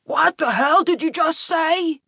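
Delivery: high, slow, threatening outburst; angry dad, words drawn out; enraged father. A narrator's reading. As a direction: demand it in drunken rage. Enraged drunken father confronts son.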